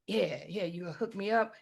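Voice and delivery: low, soft, casual dad voice; unbothered hustler dad. Retold or imitated. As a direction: brush it off and ask for the money. Retelling her unimpressed hustler dad.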